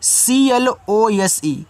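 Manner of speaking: slow letter-by-letter spelling C-L-O-S-E of सीएलओएसई; teacher spelling the word for children; spelled out letter by letter. Shown as a demonstration. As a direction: spell out सीएलओएसई slowly, one letter at a time. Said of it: "आगे देखो".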